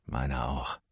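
low murmured agreement; quiet Miles. Acted. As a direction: agree under the breath. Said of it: "Miles nickte".

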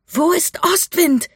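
high, drawn-out desperate yell; high-pitched urgent scream of a question; shouting into wind. A narrator's reading. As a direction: shout it slowly over the wind. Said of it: "schrie Mika gegen den Wind".

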